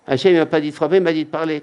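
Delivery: calming the crowd. Acted. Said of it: "Comme ça, il aurait dit".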